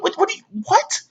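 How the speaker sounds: very high, sputtered confused whats; stunned sputter. Pretend. Bully stunned by the fake cuss, very high sputter. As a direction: splutter in disbelief. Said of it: "They're gonna wonder".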